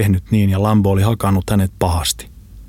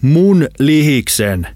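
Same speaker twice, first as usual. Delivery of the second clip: high, loud, drawn-out yell; outraged shout; 7 semitones higher, 5 dB louder, much slower than usual. A narrator's reading. Lambo protests over his own pie, shouting indignantly. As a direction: bellow it slowly in outrage. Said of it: "hän huusi".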